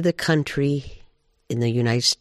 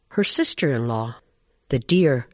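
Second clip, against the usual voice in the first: plain reading voice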